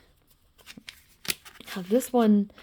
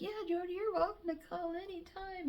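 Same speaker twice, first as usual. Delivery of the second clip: much higher, soft sing-song welcome; a friend sweetly telling Jody to call; friendly welcome; 10 semitones higher, 13 dB quieter than usual. Retold or imitated. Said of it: "he's like".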